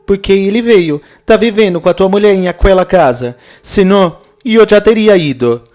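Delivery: high, fast, shocked reply; wife answering her husband in shocked disbelief. Acted. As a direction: blurt it out in disbelief, pitched high. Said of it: "Juliana ficará chocada".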